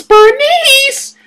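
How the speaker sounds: BERNICE shrieked in a very high voice; comic falsetto outburst; mock squeal.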